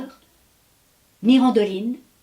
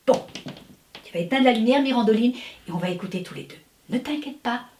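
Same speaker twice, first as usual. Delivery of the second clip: ordinary delivery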